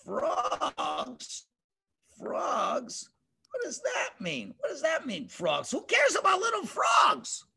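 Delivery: mocking, dismissive king, voice climbing high; scoffing Pharaoh. Pharaoh mocking Moses' frog warning, scornful. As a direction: scoff at the threat like a proud Pharaoh. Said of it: "And Paro says".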